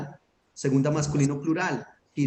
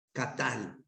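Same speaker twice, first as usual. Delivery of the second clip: katal, softer, stress on the last A; stress on final syllable; 2 semitones lower, 8 dB quieter than usual. Shown as a demonstration. Teacher repeating the word for students, katal stressed on final A. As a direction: quietly say katal with TAL stressed. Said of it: "tilde en la última A".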